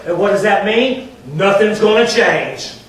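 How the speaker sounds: loud, high, drawn-out warning; emphatic preaching. Real, not acted. Preaching at full force.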